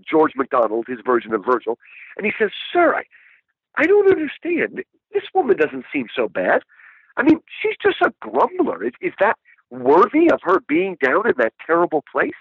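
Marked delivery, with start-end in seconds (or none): 2.7-12.3 high, earnest, bewildered question; higher voice for Lewis questioning his guide; puzzled Lewis. Retold or imitated. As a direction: ask your guide, genuinely baffled. Lewis quoted asking his guide.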